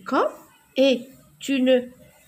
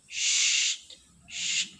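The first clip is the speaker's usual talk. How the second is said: shushing; 6 dB quieter than usual. Acted. Shushing in the story.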